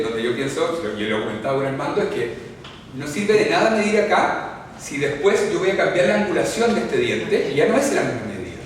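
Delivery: usual speaking voice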